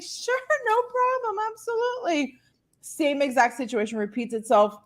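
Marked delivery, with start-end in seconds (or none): 0.0-2.3 fake breezy. Covering up to the young doctor, very high and sugary-casual. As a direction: chirp it like nothing happened. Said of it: "And I'm like".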